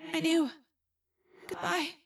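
high, hushed, fading goodbye; sad. A narrator's reading. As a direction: let the voice fade into a soft farewell. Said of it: "Rachel said sadly".

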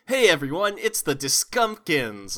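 high, loud toy commercial announcer. Pretend. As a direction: pitch it like a cheesy kids' toy ad.

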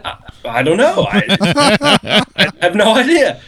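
helpless amusement at your own story; laughing while talking. Real, not acted. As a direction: struggle to talk through the laughs. Teller cracking up at own story.

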